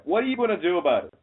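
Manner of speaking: usual speaking voice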